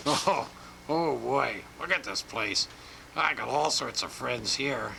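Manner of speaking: ordinary talk, nothing marked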